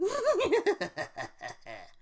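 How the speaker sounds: high, loud villain cackle; loud evil cackle; maniacal Joker laugh. Pretend. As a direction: cackle like a madman. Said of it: "my Joker impression".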